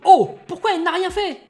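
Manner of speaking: high, loud startled question; surprised. Acted. Baguetto watching from the stands, loud startled surprise. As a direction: gasp in disbelief at the hit. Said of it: "Baguetto qui est surpris".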